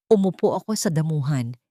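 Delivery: usual speaking voice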